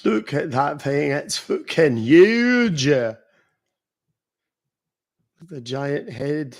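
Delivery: slow, broad Scottish exclamation; Scottish accent. Shown as a demonstration. Streamer showing off a one-liner, slow Scottish accent. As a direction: draw it out in hammed-up Scottish.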